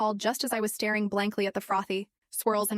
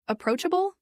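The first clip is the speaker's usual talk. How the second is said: approachable spat back high with a sharp rise; spat word; 8 semitones higher than usual. A narrator's reading. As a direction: spit approachable back at him, pitched well up. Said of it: "I practically spat the word".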